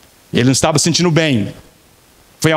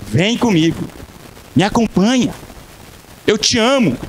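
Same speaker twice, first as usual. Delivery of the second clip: "Vem comigo" lifted much higher, warmly inviting; inviting call; 9 semitones higher than usual. Shown as a demonstration. Pastor inviting a friend to church, higher warm invitation. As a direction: call them in with a higher, pleading lift.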